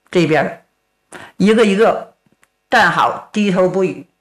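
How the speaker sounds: plain reading voice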